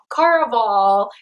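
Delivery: Caraval said as "CAR-uh-vahl". Shown as a demonstration. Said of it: "does not sound nice to me".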